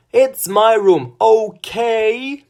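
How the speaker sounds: high, loud cracking yell. A narrator's reading. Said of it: "she yelled".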